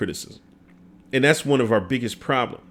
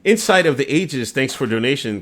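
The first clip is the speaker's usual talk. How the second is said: ordinary talk, nothing marked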